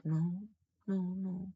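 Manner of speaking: soft, high mock-innocent no; mimicked false denial. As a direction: imitate her lying, small high voice. Imitating woman's lying denial.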